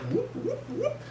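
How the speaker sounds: very high, soft whooping noise; playful falsetto sound effect; imitated pop-up sound. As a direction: mimic the creature rising up. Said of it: "it's like".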